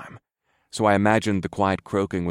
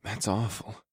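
sad sigh; 9 dB quieter, slower than usual. A narrator's reading. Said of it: "I sighed".

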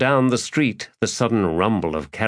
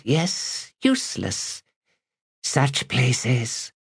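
high, theatrical hooded stranger; hooded figure voice; 5 semitones higher than usual. A narrator's reading.

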